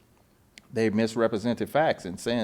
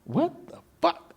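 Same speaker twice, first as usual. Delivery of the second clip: stunned outrage; 5 dB quieter than usual. Retold or imitated. Retold outrage.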